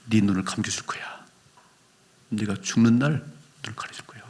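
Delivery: whispered gentle promise; tender whisper. Acted. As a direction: breathe the promise softly, like a secret. (속삭이듯 부드러운 약속)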